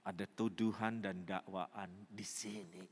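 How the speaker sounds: low, soft, heavy voice; hushed admission. Acted. Preacher voices woman's inner sense of accusation, hushed admission. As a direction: say it inwardly, as a burden. Hushed take before thundered repeat.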